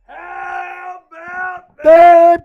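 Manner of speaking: slow, stretched, high yell; huge drawn-out victory shout; celebratory holler. Real, not acted. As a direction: holler it out across the room. Yelling in celebration.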